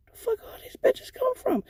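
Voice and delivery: high amazed aside; high disbelief. Retold or imitated. Stripper spotting nurses crammed in doorway, high amazed aside. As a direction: squeak it out, astonished.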